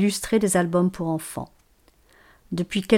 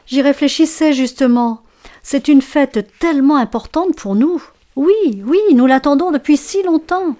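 higher, louder excited reply; eager little fir; 6 semitones higher, 5 dB louder than usual. Acted. Neighbour fir excited about the Christmas party, higher and louder. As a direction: gush about the big day. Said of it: "son voisin".

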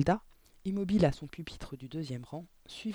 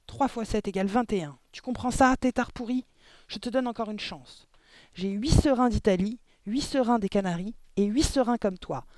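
high-pitched, raised-voice scolding; angry tirade; 6 semitones higher, 4 dB louder than usual. A narrator's reading. Headmistress drilling the pupil with insults, angry tirade. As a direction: keep berating and quizzing him, pitched up. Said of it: "hurla mademoiselle Le Gourdin".

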